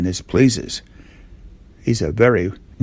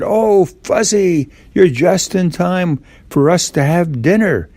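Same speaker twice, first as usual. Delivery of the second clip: much higher, slow sing-song mother voice; mother's greeting; 8 semitones higher than usual. Acted. Mother welcoming Fuzzy home for dinner, high sing-song voice. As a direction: call him in like a doting mom.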